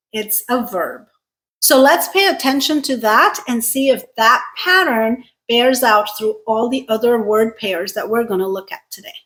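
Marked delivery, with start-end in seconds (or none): none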